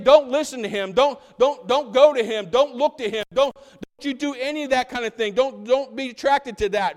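loud, high, insistent warning; urgent forbidding. Acted. Religious leaders warning the people off Jesus, urgent and forbidding. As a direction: rattle off stern don'ts as a bossy authority.

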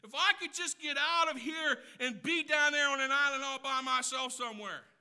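long out loud for the island; exasperated daydream of escape; wishful escape. Retold or imitated. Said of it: "has said that".

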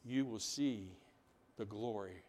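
low, soft, fading to very quiet; hushed promise. Acted. Jesus promises the glory of God, softly.

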